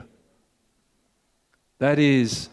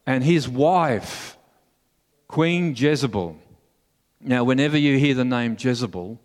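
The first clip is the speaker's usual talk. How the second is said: ordinary talk, nothing marked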